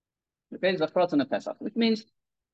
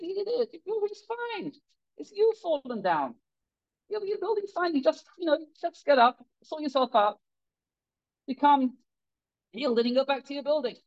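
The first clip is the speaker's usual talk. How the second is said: exasperated scolding; 9 semitones higher than usual. Acted. Family scolds the fallen builder, high exasperated rebuke. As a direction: tell him off for fussing over nothing.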